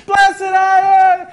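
piercing high, drawn-out shout; hammed-up loud female scream; woman screaming out. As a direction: shriek it over a crowd like a fan at a concert. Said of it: "this woman screams out".

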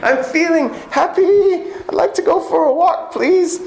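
very high, sing-out mock British voice; hammed-up Monty Python style British falsetto; bad British accent. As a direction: plead in a silly, high-pitched British accent. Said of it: "That's a really bad British accent".

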